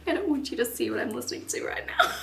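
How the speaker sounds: fast, giggling through the words; real embarrassed giggle; laughing. Real, not acted. Laughing at herself.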